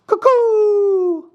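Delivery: very high, long hooted taunt; mocking cuckoo call. A narrator's reading. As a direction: jeer with a loud falsetto bird call. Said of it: "mocking them".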